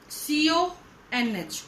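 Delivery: सीओएनएच drawn out slowly, letter by letter; spelled out slowly. Shown as a demonstration. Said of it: "देखो जरा". (सीओएनएच को धीरे-धीरे अक्षर-अक्षर बोलें)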